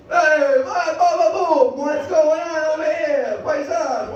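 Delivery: loud, high, hammed-up Italian accent; Italian accent impression. Comic dodging gender questions with an Italian accent. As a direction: belt it out like a cartoon Italian. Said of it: "Just talk like a fucking Italian".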